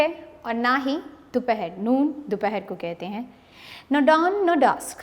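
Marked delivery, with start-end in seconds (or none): 3.9-5.0 slow, measured reading of a poem line; poem recitation. Shown as a demonstration. Teacher reciting poem to students, slow and measured. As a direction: read the verse out slowly, savouring it.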